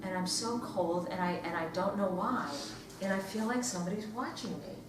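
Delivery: low, soft suspense telling, drawing them in; hushed storytelling. Acted. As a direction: keep it hushed and eerie. Hushed ghost story opening.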